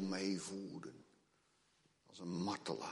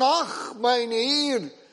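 high, loud, panicked cry to his master; frightened servant begging his master; desperate servant; 15 dB louder, much slower than usual. Acted. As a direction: cry out in terror to your lord. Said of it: "Je hoort de nood uit zijn woorden".

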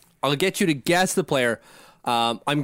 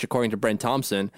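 usual speaking voice

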